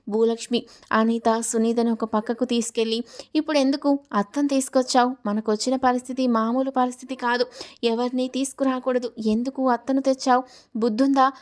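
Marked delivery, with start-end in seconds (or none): none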